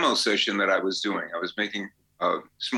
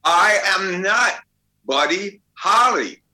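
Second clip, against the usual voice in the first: slow, much higher and louder, every word hammered; stubborn loud refusal; 8 semitones higher, 7 dB louder, slower than usual. Retold or imitated. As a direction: bellow the denial slowly, word by word. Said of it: "He says".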